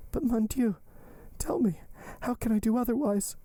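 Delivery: very high, helpless appeal; despairing Valentine. Acted. Valentine asking how she can do otherwise, despairing. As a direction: plead high, as if at a loss.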